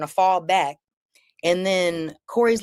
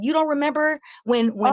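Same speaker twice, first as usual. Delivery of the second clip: high, mocking taunt; 9 semitones higher, faster than usual. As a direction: tease him from way up high. Taunting Corey in the street.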